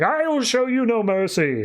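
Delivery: high, declamatory threat; hammed-up warrior's threat; mock menacing vow. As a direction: imitate a wrathful challenger. [name] threatens before attacking.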